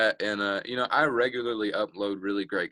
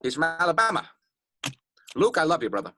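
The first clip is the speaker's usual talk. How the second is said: higher celebrity singer impression; 5 semitones higher than usual. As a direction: lift the pitch into a soul singer's speaking voice. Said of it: "[name]'s just right here".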